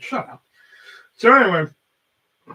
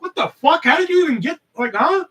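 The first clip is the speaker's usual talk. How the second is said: high, loud mock-baffled outburst; baffled disbelief; 12 semitones higher, 6 dB louder than usual. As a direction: act out total bafflement, pitched way up. Acts out baffled reaction to oddity.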